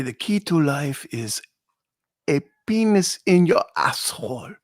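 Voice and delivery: put-on French-Canadian police accent; mock French-Canadian accent. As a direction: imitate a French-Canadian officer's accented English. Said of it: "like, Canadian Mountie dudes or French police".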